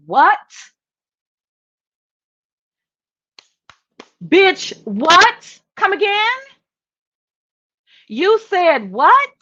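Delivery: high, loud incredulous questions; can't believe what she heard; shocked disbelief. Real, not acted. Streamer reacting to Misty's words with loud disbelief.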